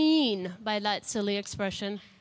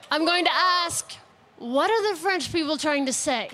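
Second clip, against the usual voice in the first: ordinary talk, nothing marked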